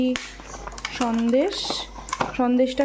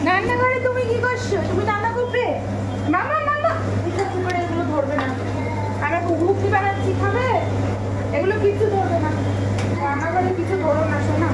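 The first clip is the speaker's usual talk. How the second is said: sing-song scolding; 7 semitones higher than usual. Real, not acted. Mother warning her toddler in the kitchen, high singsong. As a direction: warn the little one off, bright and high.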